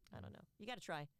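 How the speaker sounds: ordinary delivery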